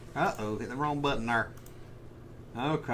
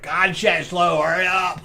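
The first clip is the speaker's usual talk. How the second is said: louder, slow, exasperated mock outburst; mock mad; 3 semitones higher, 6 dB louder, slower than usual. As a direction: complain loudly at the chat, playing at impatience. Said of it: "You ready for me to get mad?".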